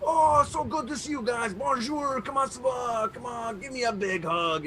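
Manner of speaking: high-pitched, gushing mock Québécois accent; French Canadian accent. Greeting festival guys, forgot to drop the French Canadian accent. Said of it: "I fucking didn't turn it off".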